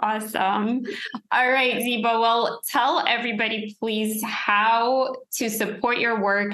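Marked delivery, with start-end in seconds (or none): none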